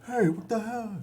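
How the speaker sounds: high confused goblin call. Retold or imitated. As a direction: lightly voice a puzzled goblin.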